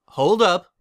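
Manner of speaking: loud stop; calling out. Acted. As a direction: halt them at the door. Luna stops them.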